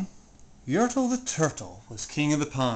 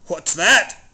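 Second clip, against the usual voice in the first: louder sharp question; snappish king; 6 dB louder than usual. Acted. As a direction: snap it down at the noise. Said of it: "snapped the king".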